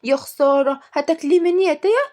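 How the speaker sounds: high-pitched mock dismay; hammed-up disappointed questioning; fake upset. A narrator's reading. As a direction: whine as if robbed of a treat. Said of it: "وقالت بزعل مصطنع".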